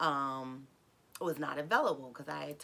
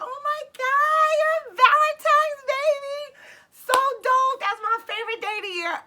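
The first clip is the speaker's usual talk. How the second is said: excited outburst; 12 dB louder than usual. Retold or imitated. Woman learning his birthday is Valentine's, thrilled high shout. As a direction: shriek it with big excitement, stretching the words. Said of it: "I got excited".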